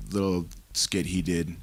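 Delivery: ordinary talk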